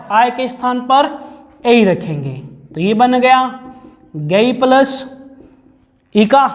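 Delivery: ordinary delivery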